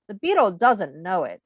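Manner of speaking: ordinary talk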